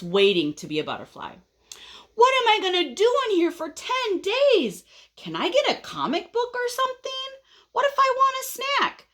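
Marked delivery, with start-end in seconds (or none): 2.1-9.1 high-pitched whiny complaining; very impatient caterpillar. Acted. Caterpillar stuck inside his chrysalis, very impatient. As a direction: fire off high, antsy questions. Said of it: "he is being very impatient".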